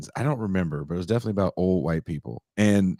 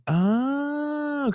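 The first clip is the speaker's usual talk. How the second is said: realising. Retold or imitated. Sudden realisation in retold story.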